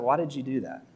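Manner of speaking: soft, calmed-down mom asking why; a settled mother gently asking her child; calm parental question. Retold or imitated. Mom at the table quietly asking her child why.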